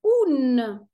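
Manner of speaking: un said as a model for the class; un sound demo. Shown as a demonstration. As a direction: model the un ending. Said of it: "on le prononce".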